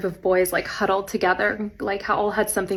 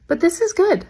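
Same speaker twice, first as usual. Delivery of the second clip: imitating student, pitched up; 10 semitones higher than usual. Student's grudging compliment, voiced.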